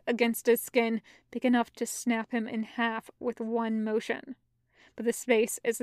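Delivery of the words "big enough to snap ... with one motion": plain reading voice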